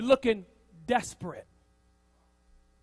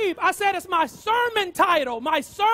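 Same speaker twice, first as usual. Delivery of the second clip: loud, higher, exasperated insistence; exasperated correction; 6 semitones higher, 6 dB louder than usual. Acted. Pastor correcting Habib about the sermon title, exasperated. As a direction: raise your voice to get it through to him.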